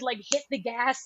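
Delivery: normal talking voice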